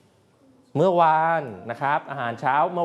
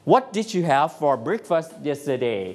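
slow model question; much slower than usual. Shown as a demonstration. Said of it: "คุณครู[name]จะถาม".

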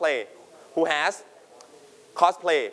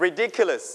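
ridiculous with the dic syllable stressed; teacher showing where the stress falls in ridiculous; slower than usual. Shown as a demonstration. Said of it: "You stress on dick".

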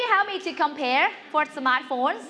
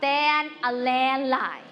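very slow, word-by-word model reading; drawn-out choral lead; much slower than usual. Shown as a demonstration. Teacher answering together with the class, drawn out slowly. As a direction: stretch each word for the class to follow.

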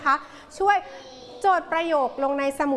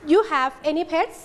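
slow "you have any pets", rising at the end; rising question tune; much slower than usual. Shown as a demonstration. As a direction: model "you have any pets" slowly, pitch climbing on "pets". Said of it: "ครูแก้วขึ้นเสียงสูง".

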